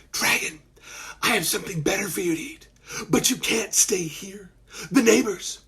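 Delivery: put-on hushed, secretive boyish voice; quiet voice. A narrator's reading. Malcolm hiding from his mom, quiet voice. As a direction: keep it down so nobody upstairs hears. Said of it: "he used his quiet voice".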